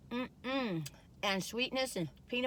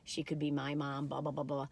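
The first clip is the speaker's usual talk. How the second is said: inner thought voice; 7 semitones lower, 6 dB quieter than usual. Retold or imitated. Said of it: "I'm like".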